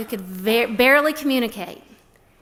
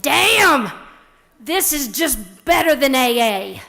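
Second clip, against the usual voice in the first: mock the lady's bold surprise; acted blunt older lady; 3 semitones higher, 6 dB louder, slower than usual. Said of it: "and she said".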